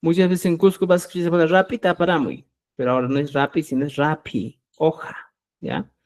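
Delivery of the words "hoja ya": ordinary talk, nothing marked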